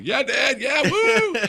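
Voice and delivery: put-on very high, loud kid cheer; imitated children's squealing cheer; cheering little boys. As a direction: whoop like excited kids egging Dad on. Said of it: "you got a picture of two little boys".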